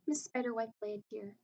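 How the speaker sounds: ordinary delivery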